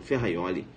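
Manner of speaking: "Ferraioli" low and soft, with a Y glide; Italian pronunciation. Shown as a demonstration. Teacher repeats the Italian name, correct pronunciation. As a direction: say "Ferraioli" quietly as fe-ha-YO-li.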